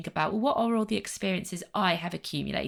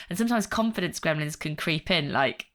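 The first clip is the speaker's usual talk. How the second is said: ordinary talk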